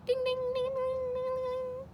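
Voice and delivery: usual speaking voice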